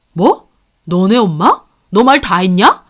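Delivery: loud, offended husband; husband taking offense at wife's words; furious husband. Acted. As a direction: bite each word back hard.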